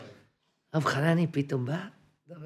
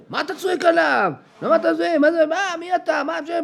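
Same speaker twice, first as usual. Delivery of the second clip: mock confused thug; 12 semitones higher than usual. Retold confrontation in parking lot.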